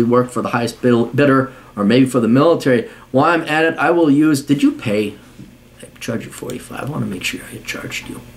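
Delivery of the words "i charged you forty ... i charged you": much softer, low and half-voiced; absent-minded low mumble; muttered aside. Real, not acted. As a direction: mutter it to yourself while checking. Checking payment aside to himself.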